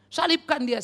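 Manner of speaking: "Salibkan dia" shouted loud and high like a mob; crowd's cry. Retold or imitated. Preacher voices the crucifying crowd, shouting. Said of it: "menyalibkan Yesus seperti dulu".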